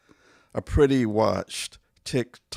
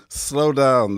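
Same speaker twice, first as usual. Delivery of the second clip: exasperated command; 4 semitones higher, 8 dB louder than usual. Acted. Nagging a reckless driver.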